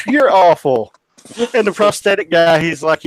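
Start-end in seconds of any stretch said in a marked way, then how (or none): 0.1-0.9 imitated scolding, high and loud; mock scolding. Echo tech finds out about the bee joke, mock-appalled scolding. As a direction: tell off the prankster in her voice.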